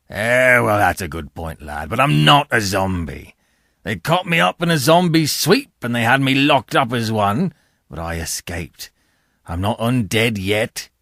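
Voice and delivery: low rough convict, grudging then defensive; low convict voice explaining himself; gruff convict. A narrator's reading. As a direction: grumble the excuse like a hunted man. Convict denies being a zombie.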